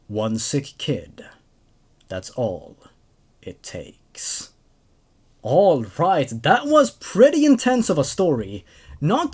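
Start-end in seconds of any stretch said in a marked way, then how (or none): 0.1-4.5 slow, low and fading to a whisper; ominous closing line. A narrator's reading. Narrator ending the horror story, slow and whispered. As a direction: let the final line drop into a chilling whisper.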